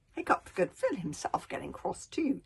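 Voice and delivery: plain reading voice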